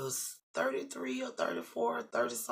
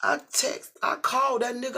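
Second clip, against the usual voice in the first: ordinary talk, nothing marked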